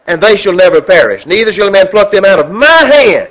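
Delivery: loud, ringing sermon delivery; preacher booming a gospel reading; preaching at full force. Acted. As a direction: thunder the scripture to the congregation. Preacher reads scripture at full force.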